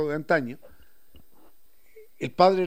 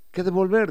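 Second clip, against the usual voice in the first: "que a devolver" lifted high with loud stress on "devolver"; "que a devolver" punched high, the Sierra way; highland Quichua-style intonation; 5 semitones higher, 6 dB louder, faster than usual. Shown as a demonstration. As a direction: Andean lilt on "que a devolver", pitch jumping up. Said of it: "y la entonación es".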